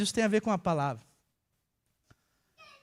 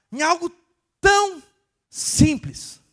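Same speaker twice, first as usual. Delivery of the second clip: much higher and louder, rising to a cry on "tão"; emotional outburst; 8 semitones higher, 8 dB louder, slower than usual. Real, not acted. Preacher moved that God spoke, loud emotional outburst. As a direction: burst out with awe at something so simple.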